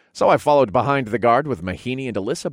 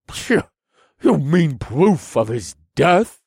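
slow, louder, a touch higher scoff; mock his choice of words; 6 semitones higher, 5 dB louder, slower than usual. Duke correcting the visitor, scoffing. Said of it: "the Duke scoffed".

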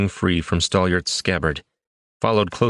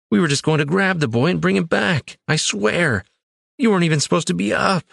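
high, rushing despairing plea; desperate pleading; 11 semitones higher than usual. A narrator's reading. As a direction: blurt it out in despair. Desperate explanation to dying friend.

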